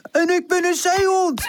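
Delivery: high, goofy put-on voice; comical call. A narrator's reading. Woezel clowning as a seal for Charlie, comical. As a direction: announce it high and silly.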